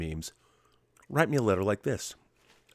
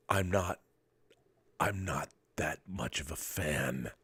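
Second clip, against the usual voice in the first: whispered, slow, much softer aside; whispered confession; 5 semitones lower, 10 dB quieter, slower than usual. Acted. Admitting to viewers he dislikes the show, whispered. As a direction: let it slip out as a secret.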